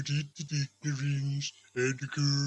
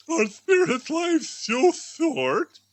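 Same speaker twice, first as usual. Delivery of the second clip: dismayed Scrooge; 12 semitones higher, 6 dB louder, slower than usual. Acted. Scrooge asks the spirit, dismayed.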